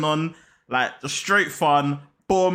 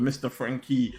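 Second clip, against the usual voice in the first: ordinary delivery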